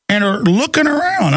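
usual speaking voice

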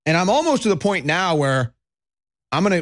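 normal talking voice

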